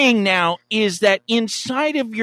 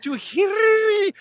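high dramatic flourish; 13 semitones higher than usual. Shown as a demonstration. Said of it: "you can't roll your R's with hear".

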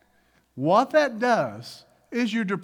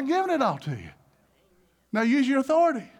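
higher-pitched, emphatic reply; God answering insistently; 7 semitones higher, faster than usual. Acted. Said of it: "He's like".

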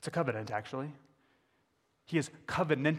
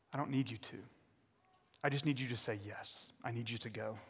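gentle reassurance; 3 semitones lower, 9 dB quieter than usual. Acted. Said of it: "God answers Moses gently".

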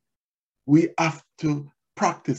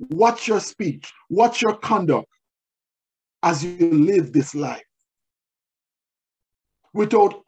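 higher-pitched, emphatic preaching; preaching exhortation; 4 semitones higher, 4 dB louder than usual. Real, not acted. Preacher urging the congregation to live holy, emphatically exhorting. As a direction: press each charge on the listeners with force.

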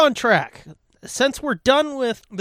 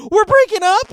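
much higher, louder, a confused squeak; mock confused squeak; 13 semitones higher, 7 dB louder than usual. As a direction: squeak it back like a baffled echo. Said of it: "I was like".

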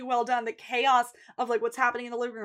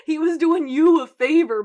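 loud, higher-pitched telling-off; mock scolding; 6 semitones higher, 11 dB louder than usual. Host telling Mickey off, mock scolding. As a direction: scold a TV character loudly.

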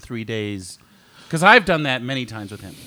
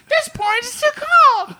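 mock Goldilocks; 5 dB louder than usual. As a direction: wail it like a fussy storybook girl. Mimics Goldilocks trying porridge.

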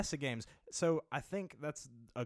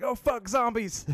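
high, loud startled yelp; mock fright; 11 semitones higher, 9 dB louder, slower than usual. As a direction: jump out of your skin. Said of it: "I was like".

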